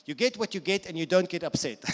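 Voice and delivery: foot-stamping insistence. Retold or imitated. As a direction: stamp it out forcefully, word by word. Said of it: "Just stamp your feet and say".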